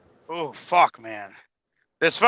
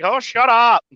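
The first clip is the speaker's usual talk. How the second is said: higher-pitched retort; mock exasperation; 7 semitones higher, slower than usual. Dispatcher answering the teasing, mock exasperation. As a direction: brush off the teasing.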